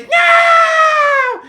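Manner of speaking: very high, loud, long shriek; terrified scream. Acted. Buttercup about to be eaten, high scream. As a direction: scream it out in cartoon terror.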